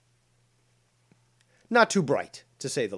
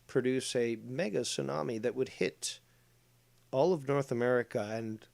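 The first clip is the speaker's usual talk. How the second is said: ordinary talk, nothing marked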